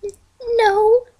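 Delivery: scared pony clinging to her friend; terrified. A narrator's reading. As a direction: stammer the no, fear rising. Twilight terrified, huddling.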